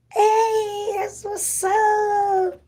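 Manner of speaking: very high, drawn-out squeal; excited cheer. Real, not acted. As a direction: squeal it out, stretching the last word. Cheering a viewer from abroad.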